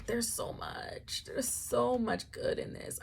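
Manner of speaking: hushed rapture; awed whisper. Real, not acted. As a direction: whisper in awe. Gushing about the book.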